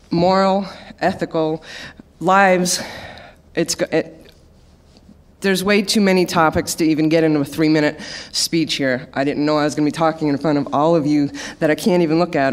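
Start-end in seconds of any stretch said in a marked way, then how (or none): none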